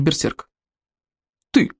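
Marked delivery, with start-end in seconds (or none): 1.5-1.7 barking. A narrator's reading. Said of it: "рявкнул главный берсерк".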